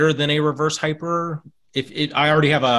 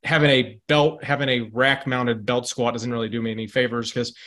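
normal talking voice

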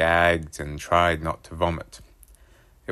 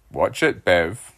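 louder, half-joking warning; mock warning dad; 6 dB louder, slower than usual. A narrator's reading. Dad mock-warning his cheeky son, louder. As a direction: warn him while hiding a laugh. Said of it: "Dad glared at me".

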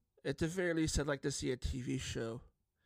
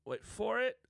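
"wait for it" pitched much higher, teasing; rising suspense; 8 semitones higher than usual. Shown as a demonstration. Host building suspense for the viewers, higher teasing voice. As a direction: tease the reveal with a high lifted voice.

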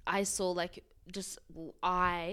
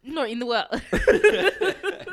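laughing; 4 semitones higher, 11 dB louder, slower than usual. Real, not acted. Laughing while answering friend.

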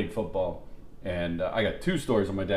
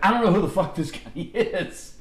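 high-pitched protest breaking into a laugh; mock yell; 8 semitones higher, 3 dB louder than usual. Opposing coach disowning the sideline heckler, half-laughing yell. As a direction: imitate a coach's exasperated holler. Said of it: "he's yelling over at Joe Redman".

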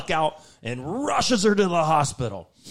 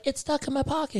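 high whiny voice; 11 semitones higher, 5 dB quieter than usual. Retold or imitated. Voicing Christine.

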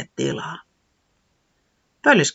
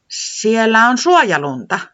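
slow, higher little voice; Tiputi hinting; 7 semitones higher, 5 dB louder, slower than usual. Acted. Tiputi hinting after a pause, slow and higher. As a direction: say it slowly as a hint. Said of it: "sanoi hetken mietittyään".

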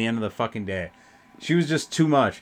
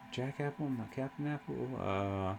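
much softer, thinking aloud; muttering to self; 16 dB quieter than usual. Real, not acted. Trying to recall which apple shot it was, quiet muttering to self. Recalling a drink name to himself.

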